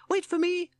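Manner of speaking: a tiny toad piping after the others; lost inside big shorts, calling out. Acted. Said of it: "called Toad".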